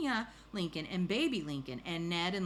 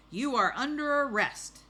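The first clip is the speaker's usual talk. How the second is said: loud, high officer's proclamation; announcing an arrest; 8 semitones higher, 8 dB louder than usual. Acted. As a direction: declare the arrest with full storybook authority.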